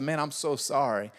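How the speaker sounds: soft, low, comforting; sympathetic pastor. Retold or imitated. Pastor consoling the teen again, soft and sympathetic. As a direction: say it gently. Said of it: "and so I said".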